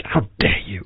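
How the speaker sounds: low, soft, cold mock scold; quiet imitation of a curt put-down; hushed mock rebuke. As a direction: hiss it under your breath at her. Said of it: "So it would be something like".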